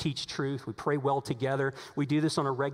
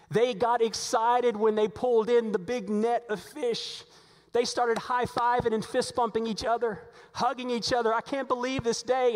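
higher, lively preaching of the disciples' joy; excited; 8 semitones higher than usual. Acted. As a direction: build up their thrill at the catch.

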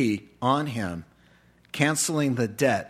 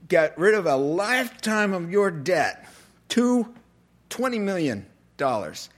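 a mild mock fury from the king; king's rebuke; 5 semitones higher than usual. King rebuking the unmerciful servant.

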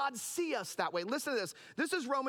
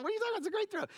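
pitched-up mock protest at the teacher; high indignant kid protest; 10 semitones higher, much faster than usual. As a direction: voice jumps up, outraged child. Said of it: "I said".

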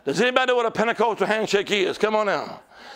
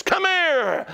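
much higher, stretched summons that slides down; calling out; 7 semitones higher than usual. Acted. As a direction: call them over from across a crowd. Voices the Lord calling someone.

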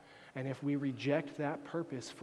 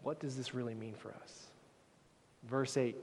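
usual speaking voice

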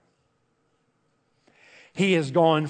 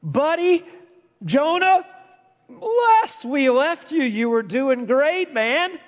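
buddy talk; 9 semitones higher than usual. Pretend. Preacher addressing Jonah like a friend.

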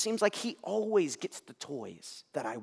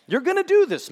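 much higher and louder booming call of God; 12 dB louder than usual. Pretend. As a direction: call out to Moses like God from above. God voiced calling on Moses.

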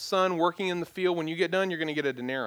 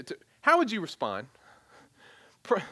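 ordinary talk

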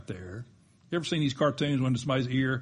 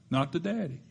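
ordinary talk, nothing marked

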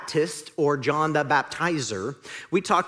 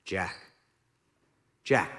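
lower, softer calm voice of God; voice from heaven; 8 semitones lower, 4 dB quieter than usual. Acted. God answering Jack from heaven, a lower calm voice. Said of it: "a voice from heaven comes".

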